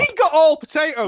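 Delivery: high, loud complaint; outraged protest. Real, not acted. Contestant protesting a rival's easy questions, loud outrage. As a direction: cry foul at the top of your voice.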